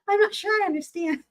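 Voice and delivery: high, puzzled judge voice; imitating Elaine, bewildered. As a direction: pitch up into a baffled judge. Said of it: "my Elaine voice".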